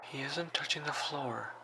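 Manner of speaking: put-on soft, high, hushed girl's voice; near-whisper it high, like a terrified child; frightened child. Hiding girl tells the operator about the man, hushed fear.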